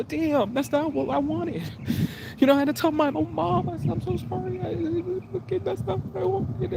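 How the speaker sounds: high, pained pleading; tearful distress. Real, not acted. Son recalling his apology to his mom, high and tearful. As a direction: apologise helplessly, voice pushed right up high.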